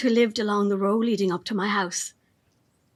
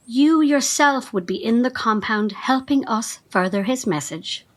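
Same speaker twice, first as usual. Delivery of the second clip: ordinary delivery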